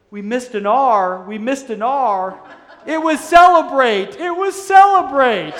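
high, loud mock wail breaking into laughter; laughing wail. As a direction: cry out the punchline while cracking up. Old monk crying, teller laughing.

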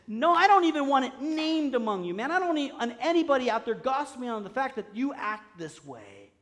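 stern command. Acted. Preacher voicing God's stern command.

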